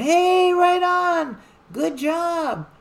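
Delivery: high, loud cheering shout. Retold or imitated. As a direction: cheer her on like a crowd. Crowd cheering her in camp.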